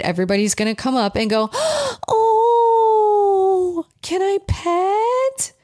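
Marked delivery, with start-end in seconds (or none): 1.5-5.5 gasp, then very high drawn-out coo; whiny puppy voice. Retold or imitated. Stranger rushing up to pet a puppy, whiny gushing. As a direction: gush over a puppy, whiny and stretched. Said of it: "the whiny puppy voice".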